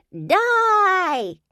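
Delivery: high piercing ghoul shriek. A narrator's reading. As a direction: shriek it like a specter. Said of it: "the fairy shrieked".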